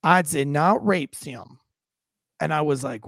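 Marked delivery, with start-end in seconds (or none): 0.0-1.6 drawn-out, unbothered imitation of a suspect; casual, nonchalant. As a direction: deny it slowly, as if it were nothing. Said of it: "just so casually and nonchalant".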